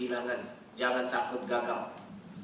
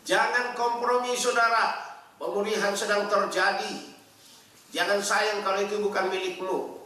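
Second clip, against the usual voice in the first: high, loud, forceful exhortation; fiery preaching; 9 semitones higher, 8 dB louder than usual. Acted. Preacher exhorts the congregation, loud and forceful. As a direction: thunder it at the congregation.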